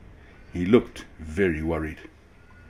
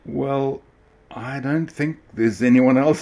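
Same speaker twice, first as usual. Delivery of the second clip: a touch of annoyance, louder; a little annoyed; 6 semitones higher, 6 dB louder, slower than usual. A narrator's reading. Small train mildly annoyed at the rude ringmaster. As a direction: answer back, mildly annoyed. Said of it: "a little annoyed now".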